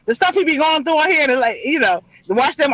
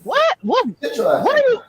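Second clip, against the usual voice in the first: a mock stunned What, pitched way up; baffled friends; slower than usual. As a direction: act out friends who can't believe it. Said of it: "they're like".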